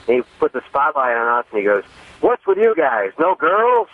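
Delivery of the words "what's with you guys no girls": high-pitched comic heckle from the stage; Don Rickles. Retold or imitated. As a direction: imitate a comedian needling his audience, pitched up. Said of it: "Don Rickles".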